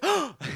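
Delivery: mock gasp. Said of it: "And he goes".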